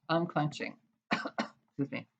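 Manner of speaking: ordinary delivery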